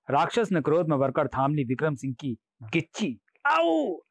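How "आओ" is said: very high, drawn-out "ow"; comic high-pitched pain cry; yelp of pain. A narrator's reading. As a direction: yelp as if grabbed by the neck. King cries out as neck is grabbed.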